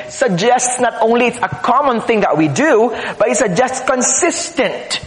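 ordinary delivery

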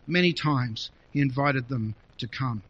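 ordinary talk

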